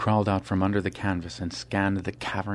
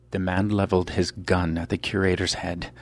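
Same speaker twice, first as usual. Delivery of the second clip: ordinary delivery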